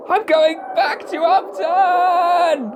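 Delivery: high, slow, thrilled exclamation; bursting with joy to go home; excited cry. Acted.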